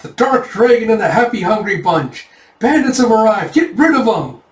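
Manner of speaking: high, excited heroic call; play-battle cry. Acted. Kids playing bandit fighters, high rallying shout.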